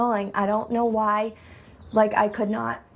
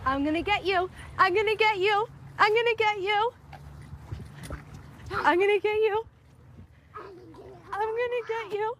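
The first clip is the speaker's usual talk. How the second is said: playful chase; 11 semitones higher, 3 dB quieter than usual. Real, not acted. Mother playing chase with her little son, high and playful.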